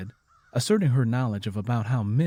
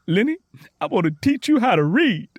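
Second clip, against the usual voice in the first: high and loud, eager to teach; excited; 10 semitones higher, 7 dB louder than usual. A narrator's reading. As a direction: burst out with the offer, thrilled. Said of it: "Tangy once again got excited".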